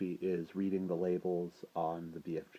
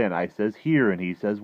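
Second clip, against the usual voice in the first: loud, animated storytelling; dream label read aloud; 4 semitones higher, 13 dB louder than usual. A narrator's reading. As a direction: read it out big and lively, pitched up a little.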